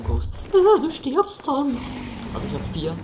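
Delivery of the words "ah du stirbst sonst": mocking imitation. Mocking friend's warning.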